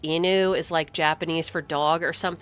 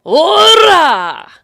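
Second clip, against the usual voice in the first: loud, high sarcastic yell; mocking shout; 11 semitones higher, 13 dB louder than usual. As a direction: shout the word back mockingly. Mock echo of game line.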